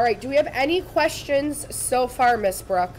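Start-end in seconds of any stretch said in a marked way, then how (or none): none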